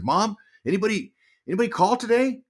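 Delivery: high-pitched retold question to mom; eager son. Son home from the draft, high-pitched asking. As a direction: lightly act out a hopeful kid asking. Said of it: "I said".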